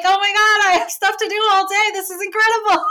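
loud, high, thrilled gushing; gushing excitement. Retold or imitated. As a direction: squeal with theater-kid delight. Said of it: "you're like".

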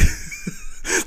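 breathless high laugh; wheezing laughter. Real, not acted. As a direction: crack up helplessly, almost without voice. Cracking up over the anecdote.

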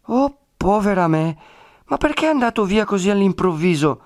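high-pitched wail of a worried woman; distressed lament. A narrator's reading. Woman learning her protector left suddenly, distressed lament. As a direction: lament it high, pleading for an explanation. Agnese laments the friar's departure.

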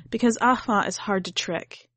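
usual speaking voice

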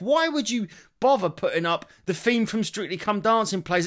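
mock "Strictly Come Dancing plays" in disbelief; exasperated. Real, not acted. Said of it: "So to someone who's deaf".

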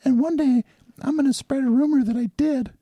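goofy cartoon wrestler voice; Hulk Hogan impression. Guest mocks Hulk Hogan to the host in a high put-on voice. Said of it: "talks like this".

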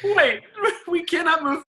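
high laughing disbelief; cracking up, stop stop. Real, not acted.

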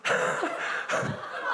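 very high, slow plummy voice; high mannered posh voice ending in a laugh; posh British. Retold or imitated. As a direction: overdo the prim Brit. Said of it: "Too British, too far".

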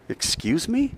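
mock indignant. Priests challenging Jesus.

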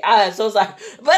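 usual speaking voice

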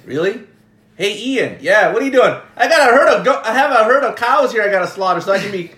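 high, loud mock-serious voice; hammed-up outrage, teasing the kids; gentle teasing. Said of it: "I'm just teasing you".

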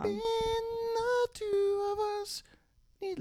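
put-on high child singing voice, slow; kid voice. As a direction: sing it high like child Michael. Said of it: "let me do the kid voice".

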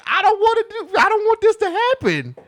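high-pitched tearful pleading; retold crying plea. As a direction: re-enact crying so she won't leave. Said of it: "I'm sitting there crying hard".

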